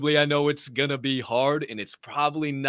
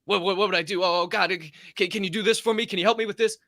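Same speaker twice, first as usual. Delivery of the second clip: higher-pitched, stammering plea; mock-flustered begging, pitched up; flustered pleader; 4 semitones higher, faster than usual. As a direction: imitate someone fumbling to ask God. Mimics people flustered asking God.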